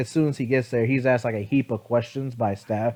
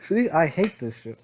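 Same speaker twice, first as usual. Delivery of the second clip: ordinary talk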